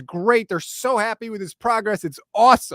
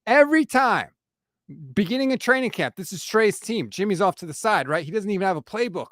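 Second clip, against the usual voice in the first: normal talking voice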